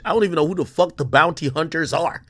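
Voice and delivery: usual speaking voice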